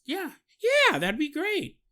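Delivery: bright, keen job applicant; young woman. Retold or imitated.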